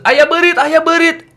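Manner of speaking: loud, high "Ayah berit" calling to dad; alarmed shout. Retold or imitated. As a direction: cry out to dad about a rat. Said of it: "kalau kamu ke Bandung".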